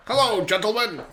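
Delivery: put-on high, loud old-timey grandpa greeting; mock the grandpa's old radio voice; imitating grandpa. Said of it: "That's how my grandpa talked".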